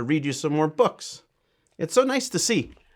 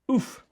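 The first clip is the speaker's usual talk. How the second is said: a sudden high little oof of impact; 13 semitones higher than usual. Acted. Penguin sliding into the cave lands with a high oof.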